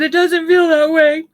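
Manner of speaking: half-mocking wail of hurt; whiny protest. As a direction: push back like a hurt child. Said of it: "And I said".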